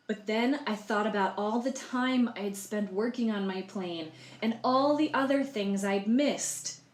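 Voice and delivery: lively read-aloud in the egg's first person, slow on the struggle; story narration. A narrator's reading. Librarian reading Humpty Dumpty's story to children, storybook voice. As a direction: tell it like a storybook to young listeners.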